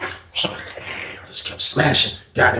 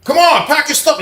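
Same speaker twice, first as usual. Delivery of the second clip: high loud guard voice; 4 dB louder than usual. Retold or imitated. Guard ordering.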